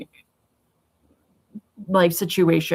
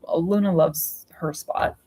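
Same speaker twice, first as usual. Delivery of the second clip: ordinary talk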